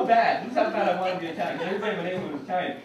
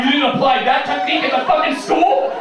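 incredulous yell; 6 semitones higher, 11 dB louder than usual. Retold or imitated.

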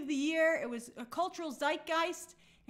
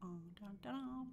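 dramatic sting; 5 semitones lower, 15 dB quieter than usual. Real, not acted.

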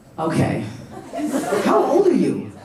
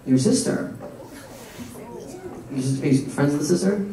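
ordinary talk

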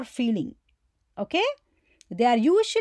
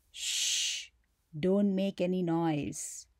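whispered, much softer hush; 6 semitones lower, 9 dB quieter, slower than usual. Shown as a demonstration. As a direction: hush them so nobody hears. Mickey Mouse hushing someone.

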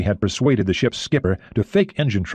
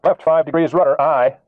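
helmsman calls the order back, higher and louder; crisp naval acknowledgement; helmsman's reply; 6 semitones higher, 4 dB louder than usual. Acted. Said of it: "the helmsman responded".

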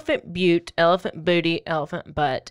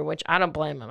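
ordinary talk, nothing marked

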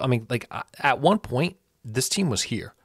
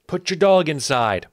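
louder, higher call to the neighbors; 6 semitones higher, 6 dB louder than usual. Real, not acted. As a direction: yell it toward the neighbors' yard. Neighbors' dog barking during video.

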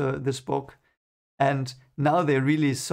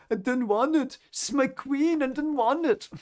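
high, strained plea of a reluctant hero; pleading Rand; 11 semitones higher than usual. Retold or imitated. Rand pleading with his friends, high and strained. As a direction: squeeze it out high, like a confession he hates. Rand's anguished confession voiced.

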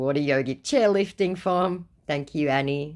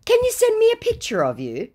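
much higher and louder, pleading; mock scammer voice; 6 dB louder than usual. Scammer asking for photos, mocked in a high voice. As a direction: squeak out the creepy request.

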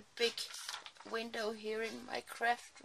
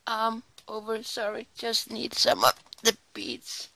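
usual speaking voice

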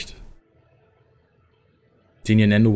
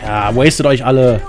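excited cheering; 3 semitones higher than usual. Real, not acted. Cheering the final fight.

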